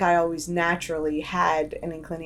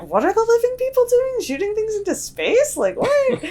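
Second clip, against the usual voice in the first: high baffled ghost gossip; squeaky bewildered old ghost; 4 dB louder than usual. Retold or imitated. Ghosts gossiping, voiced by speaker.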